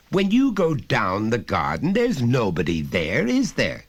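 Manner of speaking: high, loud, lecturing father voice; a father talking down to his son, patiently insistent; stern father. Acted. Father pressing his small son, loud and high.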